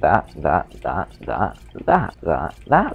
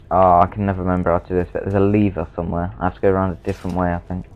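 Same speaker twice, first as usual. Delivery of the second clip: ordinary talk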